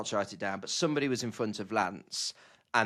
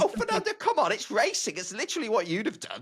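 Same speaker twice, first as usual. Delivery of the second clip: exasperated; 9 semitones higher than usual. Acted.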